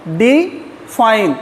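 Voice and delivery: "Define" very slowly, डि, pause, fine; split into syllables. Shown as a demonstration. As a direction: say "define" as two drawn-out, separate syllables. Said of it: "दो बार में आप बोल रहे हैं".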